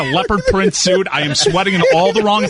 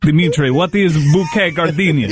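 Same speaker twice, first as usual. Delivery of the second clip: put-on Russian accent. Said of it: "[name]'s fake Russian accent".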